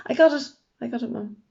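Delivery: loud, high burst of eagerness; blurting out to stop mum oversharing; excited outburst. A narrator's reading. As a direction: jump in eagerly to cut her off. Girl interrupts mum loudly.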